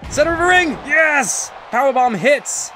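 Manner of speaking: high, shouted move call; triumphant ring commentator; thrilled play-by-play. Real, not acted. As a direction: cry out each beat as it lands. Calling a powerbomb that lands.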